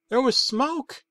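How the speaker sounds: ordinary delivery, nothing marked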